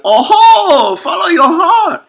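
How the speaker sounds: high, loud hammy swallow voice; high, shouting cartoon bird; hammed-up swallow. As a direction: belt it like a cheesy mentor.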